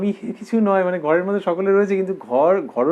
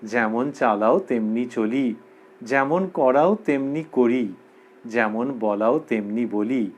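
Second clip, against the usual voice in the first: slow, lower, measured chant of the verse; slow verse recitation; 5 semitones lower, slower than usual. Acted. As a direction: recite each line slowly like a prayer. Recites Ramakrishna's devotional verse.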